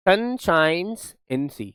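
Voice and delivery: very slow, each word drawn out; slow reading. Shown as a demonstration. Teacher reading the example sentence slowly to the class. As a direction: read Sun shines in sea word by word, slowly. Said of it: "example sentence read slowly".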